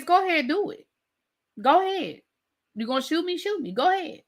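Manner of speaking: high, teasing go ahead; taunting dare. Retold or imitated. Gigolo daring the woman holding a gun, high and teasing.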